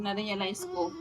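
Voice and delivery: ordinary talk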